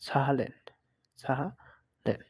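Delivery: "সাহালেন" with tongue behind upper teeth for the Z; German Z demo. Shown as a demonstration. Teacher demonstrates the letter Z, careful pronunciation of "সাহালেন". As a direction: sound out "সাহালেন" slowly in separated parts. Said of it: "রাখা অবস্থায় স উচ্চারণ".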